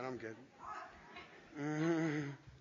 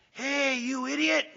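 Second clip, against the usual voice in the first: mock yell at the idiot; tossing out a gruff insult; retold insult; 4 semitones higher, slower than usual. Construction worker yelling at the speaker. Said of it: "He says".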